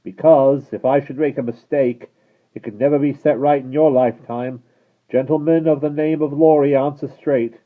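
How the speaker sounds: loud high stern call; guard warning the rider; shouted warning. Acted. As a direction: warn him firmly.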